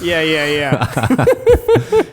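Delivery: slow yeahs through laughter; laughing yeahs. Real, not acted. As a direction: laugh out each yeah. Laughing at the joke.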